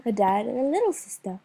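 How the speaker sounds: usual speaking voice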